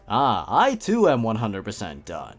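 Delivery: ordinary talk, nothing marked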